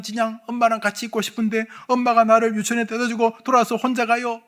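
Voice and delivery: put-on a hurt little girl telling on her mother; upset child pleading. Client speaking as upset child.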